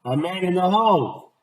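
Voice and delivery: boy wailing at his bad luck; dismayed boy.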